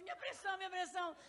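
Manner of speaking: hammed-up fretful friend; mock fretting friend. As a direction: play the anxious hypochondriac. Friend frets about blood pressure.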